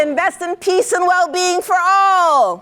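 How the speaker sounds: rally the crowd with each prompt; shouted prompts. Shown as a demonstration. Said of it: "calling out prompts to audience".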